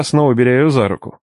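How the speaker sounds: usual speaking voice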